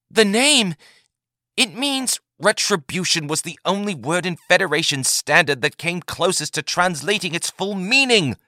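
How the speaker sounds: puzzled protest; confused Taith. A narrator's reading.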